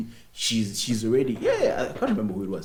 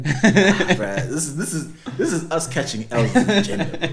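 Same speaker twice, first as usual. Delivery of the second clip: much higher, laughing bruh; laughing in disbelief; 7 semitones higher, 7 dB louder than usual. Real, not acted. Host cringing at the guy's message, laughing higher. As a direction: laugh through it, embarrassed for him.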